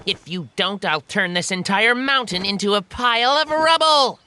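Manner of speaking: mild shouted threat, building; shouting monkey. Acted. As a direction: threaten the demon, rising to the last word. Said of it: "shouted the monkey".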